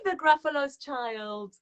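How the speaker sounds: ordinary delivery, nothing marked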